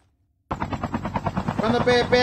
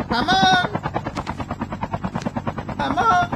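llamada alegre; 8 semitones higher, much slower than usual. Real, not acted. Llamando a un jugador en partida.

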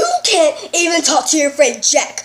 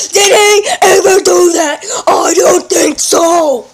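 loud scolding shout; angry yell; 2 semitones higher, 6 dB louder than usual. Acted. Dad yelling at son about his brother, loud angry shout. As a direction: bellow it at the kid.

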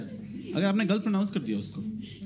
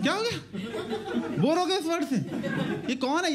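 mock bafflement; 8 semitones higher than usual. Mocking word change.

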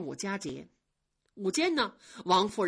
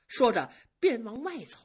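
usual speaking voice

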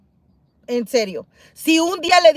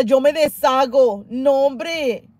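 usual speaking voice

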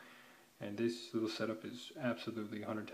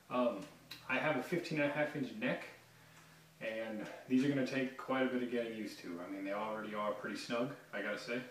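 ordinary talk, nothing marked

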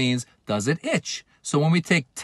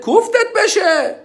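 much higher, slow mocking scold; disgusted scolding; 4 dB louder, slower than usual. Scolding a show-off flaunting money, high drawn-out sneer. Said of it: "showing off with it".

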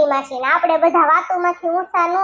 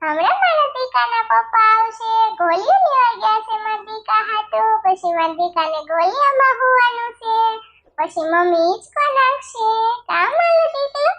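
put-on baby talk; 6 semitones higher than usual. Mother soothing her crying baby in high baby talk. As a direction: coo to a crying infant in a much higher voice.

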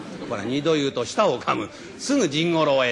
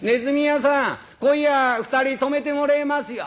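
higher, louder traveler's voice calling to the innkeeper; guest calling in; 10 semitones higher, 4 dB louder than usual. Acted. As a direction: call up brightly at the inn door. (宿の客の呼びかけ)

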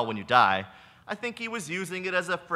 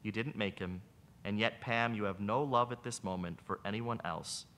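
soft, sorrowful answer; sad retort; 8 semitones lower, 7 dB quieter than usual. Acted. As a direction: say it with quiet sorrow. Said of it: "Sadly, the brother retorts".

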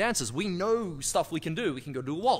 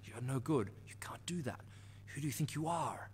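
hushed, unvoiced whisper of self-doubt; inner critic voice; 3 semitones lower, 10 dB quieter than usual. Coach voicing the viewer's inner critic in a sneering whisper. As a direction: breathe it like a nagging voice in your head. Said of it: "this voice coming at you saying".

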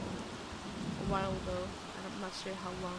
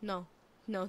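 normal talking voice

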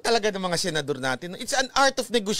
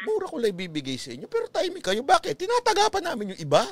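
high, hushed, conspiratorial voice; playing China; 8 semitones higher than usual. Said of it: "eto ang China sa atin".

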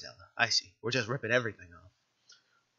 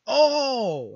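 loud, high, drawn-out exclamation; big startled "oh"; sudden realization; 11 semitones higher, 12 dB louder than usual. Real, not acted. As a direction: cry out as it dawns on you. Player suddenly realizes a mistake.

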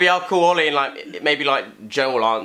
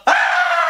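pain yelp; 4 dB louder than usual. Real, not acted. Hit toe hard.

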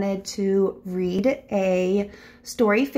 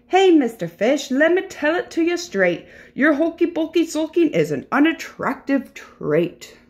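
higher, emphatic talking-to; frank, scolding octopus; 6 semitones higher, 3 dB louder than usual. Acted. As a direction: tell the sulker off, storybook style.

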